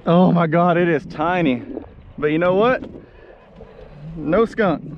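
usual speaking voice

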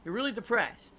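mocking. Mocking a depressed streamer.

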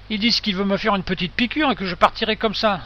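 usual speaking voice

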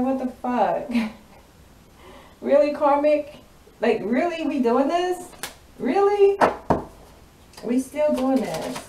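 high, loud disbelief, acting out her outburst; incredulous exasperation. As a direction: play her as loudly fed up and incredulous. Acted quote of exasperated, incredulous femme.

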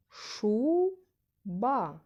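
"Шуба" split, with a loud high drawn-out first syllable; stress on first syllable. Shown as a demonstration. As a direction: say "Шуба" as SHUUU... ba. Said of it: "Наголошений склад перший".

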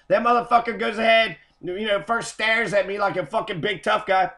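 usual speaking voice

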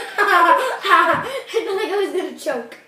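slow, breathless laughing words. Real, not acted. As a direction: laugh it out while recovering. Laughing after spitting marshmallows out.

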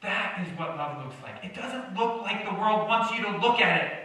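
usual speaking voice

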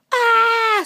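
high, loud chipmunk scream; acted shriek of fright. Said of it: "screamed Jacques".

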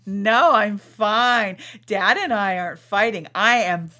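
loud, slow, drawn-out insistence; mock insistence. Mom answering daughter who asks about fighting, exaggerated insistent denial. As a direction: insist you're fine, stretching each word in mock protest. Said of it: "She hates that".

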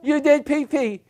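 high cheer easing into pet-owner cooing; mock puppy praise. Husband praising wife as if training a puppy, exaggerated delight. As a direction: celebrate loudly, then coo the reward up high. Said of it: "every time my wife goes to the bathroom".

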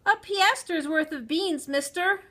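put-on high, loud child's call; shrill call. A narrator's reading. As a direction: call out shrilly over a crowd. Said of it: "I called out in my shrill voice".

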